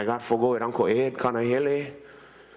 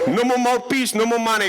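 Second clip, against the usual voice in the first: excited outburst; 9 semitones higher, 6 dB louder than usual. Retold or imitated. Excited memory of football lack.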